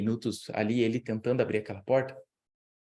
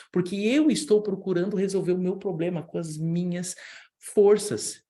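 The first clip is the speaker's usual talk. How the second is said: normal talking voice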